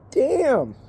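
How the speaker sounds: ordinary delivery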